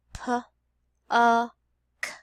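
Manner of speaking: "P, uh, k" as three separate sounds with pauses; sounded out. Shown as a demonstration. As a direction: split "P, uh, k" into single sounds, each one clearly apart. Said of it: "let's finger stretch that word".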